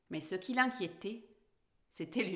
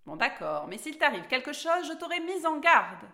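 loud, grudging warning that climbs higher; scolding; 5 semitones higher, 6 dB louder than usual. A narrator's reading. Cat gives in but warns Alice, scolding. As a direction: give in, then scold insistently. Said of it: "gronda le chat".

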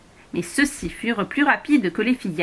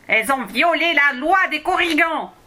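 higher, louder angry goblin; roar; 8 semitones higher, 4 dB louder than usual. Acted. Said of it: "rugit celui-ci".

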